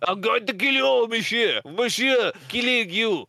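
mock Bordeaux French accent, high-pitched; hammed-up French threat with rising gusto; [name]. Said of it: "done it well in my Bordeaux accent".

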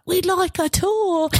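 put-on high, drawn-out British boys' chorus; British schoolboy accent. British schoolboys asking a guide for a tour, British accent. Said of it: "like, a British boys boarding school".

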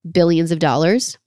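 ordinary talk

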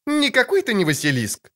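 put-on a sharp, high girlish retort; girl's voice. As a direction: contradict him like a cheeky girl. Ciri voice.

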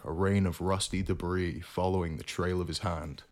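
plain reading voice

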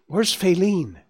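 high, anxious question; agitated, impatient. A narrator's reading. Bambi asking Gobo after Faline, agitated and impatient. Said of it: "Bambi spoke quickly, agitated, impatient".

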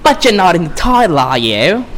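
put-on botched New York-British accent; Harley Quinn. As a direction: taunt him in a put-on character voice. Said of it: "That was a shitty Harley Quinn impression".

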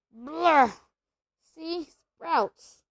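loud, disgusted yuck at a bad bean; disgusted spluttering. A narrator's reading. Ron tasting a sprout-flavored bean, loud disgusted spluttering. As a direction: spit out the taste and complain.

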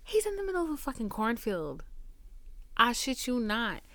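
usual speaking voice

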